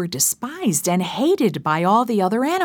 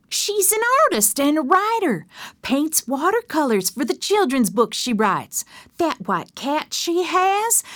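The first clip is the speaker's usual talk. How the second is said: higher, chattering neighbor gossip; gossipy Emma; 6 semitones higher than usual. Acted. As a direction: rattle it off eagerly.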